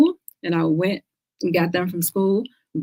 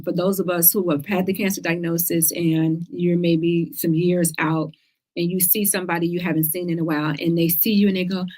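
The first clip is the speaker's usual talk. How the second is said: ordinary delivery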